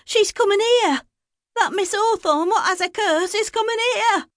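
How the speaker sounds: put-on a scared boy blurting the warning; frightened young boy. A narrator's reading. Frightened boy warns grandfather.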